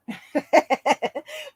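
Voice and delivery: light laugh after ranting; real laugh. Real, not acted. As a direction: laugh at yourself. Laughs after his rant.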